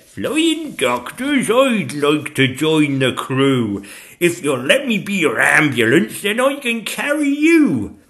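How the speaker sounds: high, loud, drawn-out dragon voice; volunteer keenly as a little dragon; eager young dragon. Acted. Zog volunteers as ambulance.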